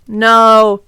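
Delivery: hammed-up villainous refusal, loud; loud, mocking villain voice; crude villain. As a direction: refuse like an evil overlord. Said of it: "he laughed crudely".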